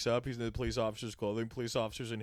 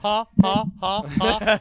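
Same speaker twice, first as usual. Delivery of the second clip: loud, high, deliberate ha ha like an alien; robotic laugh; 8 semitones higher, 12 dB louder, slower than usual. Acted. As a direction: play a robot faking laughter. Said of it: "I love your human sense of humor".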